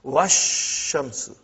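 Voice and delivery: Wash shamsu slow, the sh doubled with no alif or lam; slow assimilation demo. Shown as a demonstration. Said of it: "alif and lam both were assimilated".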